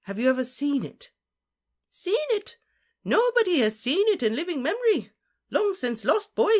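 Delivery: high, loud, incredulous retort; excited high character voice; high-voiced story figure. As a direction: pitch it well up, exclaiming in disbelief. Story figure answering a question.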